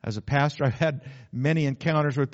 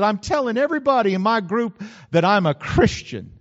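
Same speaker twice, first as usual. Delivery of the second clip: higher and louder, pressing on; raised confession; 7 semitones higher, 7 dB louder than usual. Retold or imitated. Preacher voicing the girl's confession, raised and pressing. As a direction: tell it like it hurts to admit. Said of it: "she said".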